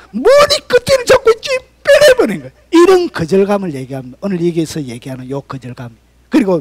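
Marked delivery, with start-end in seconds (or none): 0.1-2.5 anguished preaching cry. Acted. Dramatic pulling out in line.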